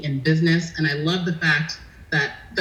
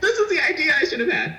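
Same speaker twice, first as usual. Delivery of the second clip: high, quick retold lament through laughter; laughing mock dismay; 12 semitones higher, faster than usual. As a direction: rush it out high, amused at yourself. Retold reaction to a great idea.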